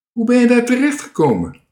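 high, loud, fast call. A narrator's reading. Dikke Druif calls up to Toontje, loud high call. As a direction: shout the question up at someone. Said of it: "riep Dikke Druif".

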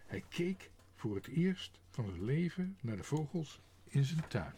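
usual speaking voice

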